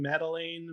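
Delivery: Madeline ending like "lane"; name pronunciation guess. Shown as a demonstration. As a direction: say Madeline with a long A in the last syllable. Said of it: "what's her name".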